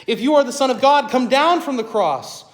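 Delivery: mock him from below the cross; passersby taunting Jesus on the cross; deriding taunt. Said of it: "derided him".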